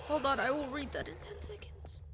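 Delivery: soft unvoiced whisper. Real, not acted. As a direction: breathe it without voice. Whispering while reading in game.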